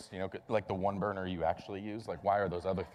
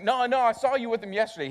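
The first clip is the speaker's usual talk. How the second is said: much higher, louder mock insistence; insistent accuser; 11 semitones higher, 8 dB louder than usual. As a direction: push back hard, almost shouting. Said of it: "He's like".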